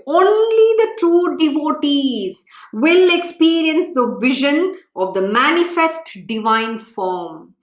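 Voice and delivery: slow, weighty, every word spaced; slow emphatic repeat. Shown as a demonstration. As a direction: drive the words home slowly so they remember. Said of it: "Please remember the words".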